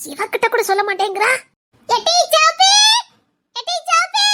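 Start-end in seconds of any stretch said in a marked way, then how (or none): 1.9-4.3 high loud call of the name. Acted. Old woman calling Soppi out of the house. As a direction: call her name sharply.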